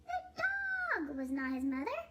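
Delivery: ordinary delivery, nothing marked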